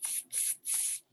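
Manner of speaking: soft hissed tsk-tsk, unvoiced; monkey tsk-tsk. Monkeys mocking the peddler from the tree, whispered tsk. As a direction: tease him back like a cheeky monkey.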